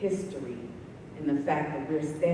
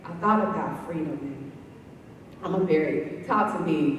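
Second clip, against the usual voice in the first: ordinary talk, nothing marked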